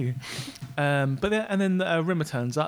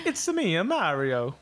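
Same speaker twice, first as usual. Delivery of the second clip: Italian accent; slower than usual. Shown as a demonstration. Co-host offering a sample line in a mock Italian accent. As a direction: put on a cartoon Italian accent. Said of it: "something to say with an Italian accent".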